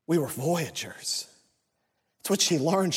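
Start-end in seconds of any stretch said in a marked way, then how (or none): 0.1-1.3 hushed awe. Acted. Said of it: "Moana cries out".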